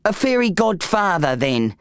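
plain reading voice

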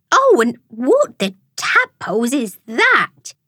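slow, swooping shock with leaping pitch; gasping. A narrator's reading. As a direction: draw it out, aghast, punching key words. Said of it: "gasped Frida".

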